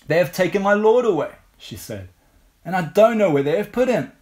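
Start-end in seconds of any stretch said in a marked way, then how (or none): 0.1-1.3 higher, louder and quick; distressed reply. A narrator's reading. Reads Mary's distressed reply aloud. 2.6-4.1 raised, louder pleading in narration; distressed reply. A narrator's reading. Reads Mary's distressed reply aloud.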